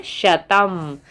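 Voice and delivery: ordinary talk, nothing marked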